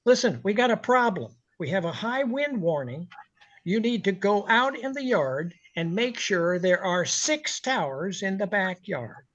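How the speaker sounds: high, pushy boss voice, rising to a shout; mock boss barking orders; lightly voiced demanding owner, building to a yell; imitating a bossy owner. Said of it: "[name] is impersonating Stan Kaplan".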